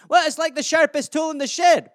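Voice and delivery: hammed-up Scottish lilt; Scottish accent. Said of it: "it's a Scottish euphemism".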